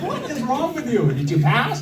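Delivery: very high, incredulous squawk easing slightly; exasperated disbelief. Retold or imitated. Brother retelling his demand for exam results, exasperated. Acted outraged question to oblivious brother.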